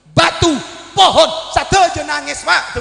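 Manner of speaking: high, loud preaching cry; impassioned outcry. Acted. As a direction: cry it out so all feel it. Preacher cries out climax. (seruan penuh haru dengan suara lantang)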